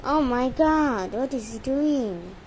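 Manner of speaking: ordinary talk, nothing marked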